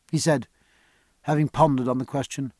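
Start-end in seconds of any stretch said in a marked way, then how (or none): none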